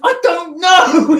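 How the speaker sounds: high, loud, drawn-out mock wail; helpless footballer. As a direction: exclaim it in comic helplessness. Joey helplessly answers agent in story.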